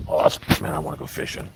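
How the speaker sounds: soft whispered aside to himself; real hushed whisper. Real, not acted. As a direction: breathe the words, no voice. Walking alone, muttering to himself.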